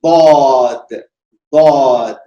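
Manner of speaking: the right version, firm and low; correct dwad. Shown as a demonstration. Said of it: "सही तलफ्फुज ये है".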